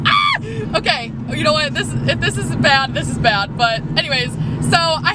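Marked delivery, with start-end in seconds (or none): 0.1-0.4 startled yelp. Real, not acted. Startled while driving.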